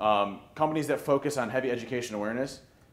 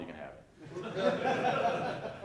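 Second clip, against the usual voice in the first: laughing; 8 semitones higher, 3 dB quieter, much slower than usual. Real, not acted. Laughing aside about giving wallet.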